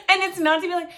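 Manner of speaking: words spilling through a high laugh. Real, not acted. Amused by her own impression, laughing through the words. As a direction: giggle your way back into the sentence.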